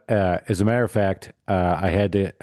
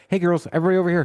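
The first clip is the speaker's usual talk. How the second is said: put-on high, bubbly party girl voice; mock selfie girl; 7 semitones higher, much faster than usual. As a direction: call the girls over, high-pitched. Mocking Gen Z girls taking selfies.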